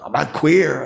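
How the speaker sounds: high-pitched retold scream; yelled. Embarrassed man yelling denial to onlookers, mock scream. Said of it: "I was like, yelled".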